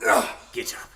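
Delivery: ordinary talk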